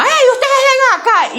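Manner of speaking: high-pitched summons across the schoolyard; imitated teacher calling out. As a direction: call the pupils over, acting the teacher. Teacher calls girls over.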